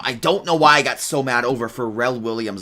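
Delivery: ordinary talk, nothing marked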